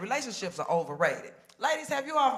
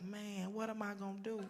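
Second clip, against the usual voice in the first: soft despair; 12 dB quieter than usual. Retold or imitated. Heartbroken woman quoted.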